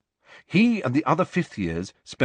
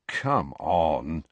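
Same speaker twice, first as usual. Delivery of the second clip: lower, deflated sigh of a line; hopeless; 6 semitones lower than usual. Acted. Harry dismisses Ginny's idea, lower and hopeless. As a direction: give up before you say it. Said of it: "said Harry hopelessly".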